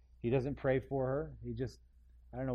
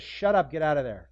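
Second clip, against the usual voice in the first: blunt command; 3 semitones higher, 9 dB louder than usual. Acted. Jesus commanding the demon.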